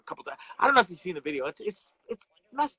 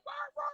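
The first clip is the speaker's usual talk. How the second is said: imitating a dog's yelp; 8 dB quieter than usual. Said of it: "He's just like".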